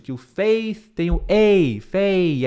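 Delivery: "faith" with the ei of fei. Shown as a demonstration. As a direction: lean on the ei in "faith". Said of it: "fica ligado".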